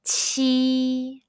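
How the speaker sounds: "qi" spoken with a big smile and strong puff; "qi" aspirated, high level tone; "qi" with spread lips; Mandarin qi. Shown as a demonstration.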